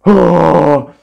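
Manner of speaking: loud exhaled sigh of relief; heavy loud sigh. Real, not acted. Gamer recovering from fright with a loud sigh. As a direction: blow out a big sigh.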